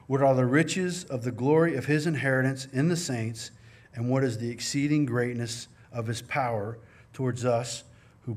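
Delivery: solemn, measured reading of scripture. Acted. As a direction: read the verses out as holy words. Reads Ephesians aloud.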